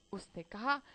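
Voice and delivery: plain reading voice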